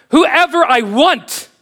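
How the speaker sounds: God's answer. Acted. Preacher voices God answering the congregation, slow and commanding. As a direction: declare it as God with full authority.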